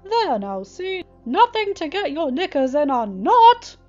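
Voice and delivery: loud, high shout across the bridge. A narrator's reading. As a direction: yell it over to them, carefree. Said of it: "He shouted that last word".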